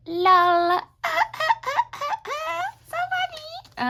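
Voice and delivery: squeaky high laugh through the words; high giggly laugh. Real, not acted. Girl looking at her old drawing, laughing up high. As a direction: giggle up high, amused at her own joke.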